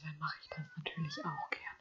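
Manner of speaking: tonlos, sehr leise; flüster es ironisch; Flüstern. Real, not acted. Ironisches Angebot geflüstert. (geflüsterte Ironie)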